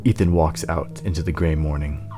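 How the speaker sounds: ordinary talk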